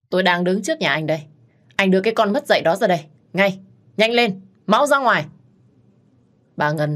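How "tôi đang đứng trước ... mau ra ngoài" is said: rising to a much higher, louder yell; furious shouting command. A narrator's reading. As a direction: order the son out, raging. Angry mother orders son out. (bà mẹ quát tháo ra lệnh qua điện thoại)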